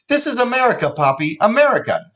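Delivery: very high, urgent emphatic cry; pleading shout. Acted. Mother shouting a reminder at her husband. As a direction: hammer home the word America. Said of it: "she reminded him now".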